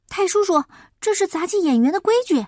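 put-on eager boy. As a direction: press him quickly, earnestly. Boy insists on the rules.

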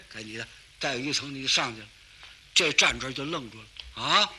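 usual speaking voice

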